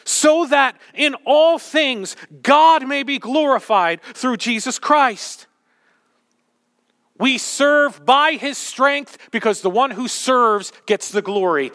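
loud, slow, driving pulpit voice; full-force preaching. Real, not acted. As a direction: preach it to the rafters.

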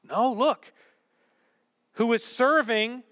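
ordinary delivery

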